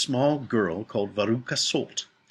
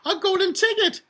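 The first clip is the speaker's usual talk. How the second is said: very high, loud squeal of triumph; shrill-pitched shout of discovery; excited yell; 7 dB louder than usual. A narrator's reading. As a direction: yell it out as a thrilled factory worker. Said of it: "one of my women workers yelled".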